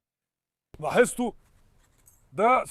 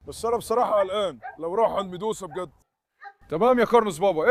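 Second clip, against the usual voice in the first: ordinary talk, nothing marked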